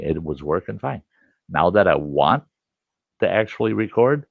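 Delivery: normal talking voice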